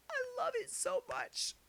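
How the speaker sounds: high squeaky gleeful voice; mock delight. Real, not acted. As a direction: squeal it with sarcastic glee. Gleeful sarcastic aside.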